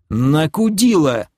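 high, drawn-out annoyed answer; exasperated retort. Acted. Answering the elf's silly question, exasperated. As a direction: toss it back in irritation. Narrator snaps at the elf.

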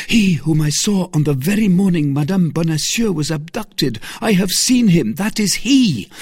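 high, loud tirade, building in agitation; angry outburst. Acted. D'Artagnan telling Athos of his enemy, angry. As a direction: pour out the accusations, mounting to a cry. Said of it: "pale with anger".

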